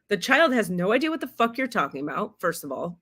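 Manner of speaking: usual speaking voice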